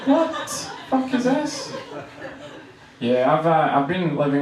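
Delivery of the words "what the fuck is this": high, slow mock disbelief; retold bafflement. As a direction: draw it out high in confusion.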